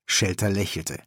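ordinary delivery

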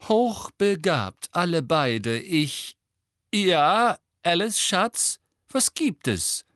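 high, loud grandmother voice; a grandmother holding forth, then addressed by her daughter-in-law; imperious grandmother. A narrator's reading. Grandmother breaking off her boast to answer Alice, high and emphatic.

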